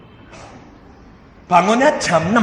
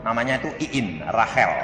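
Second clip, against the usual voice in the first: normal talking voice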